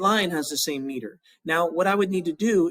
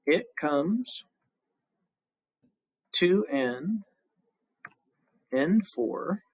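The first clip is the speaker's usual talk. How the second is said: counting syllables; much slower than usual. Shown as a demonstration. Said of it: "So every is two syllables there".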